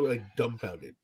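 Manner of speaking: ordinary delivery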